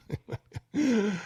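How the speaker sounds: soft, high chuckle; amused laugh. Real, not acted. Host reacting to a listener's cheeky question, amused laugh. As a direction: let out a small real laugh.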